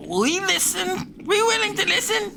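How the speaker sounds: very high squeaky creature voice; cowering kobolds. Acted. Kobolds answering the intimidating threat, high squeaky meek surrender. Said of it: "at which point they say".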